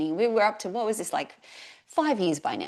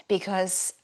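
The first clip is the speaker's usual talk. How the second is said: usual speaking voice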